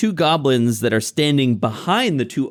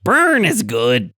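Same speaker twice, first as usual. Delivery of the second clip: higher, emphatic goblin voice; boastful goblin; 5 semitones higher, slower than usual. Acted. Gibdar the goblin defying his boss, smug and boastful. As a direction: proclaim it proudly in broken goblin speech.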